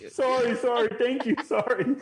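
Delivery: "Sorry" squeaked out high through giggles; laughing apology. Real, not acted.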